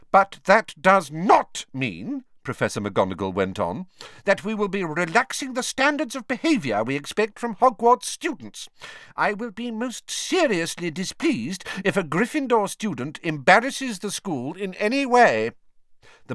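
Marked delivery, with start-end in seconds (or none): 0.1-2.2 slow, high and loud, weighing each word; stern warning. A narrator's reading. Professor cautioning her class about behaviour, stern. 4.2-15.5 high, severe lecturing voice; stern teacher figure. A narrator's reading. Professor warning students not to embarrass the school, stern. McGonagall warns the class.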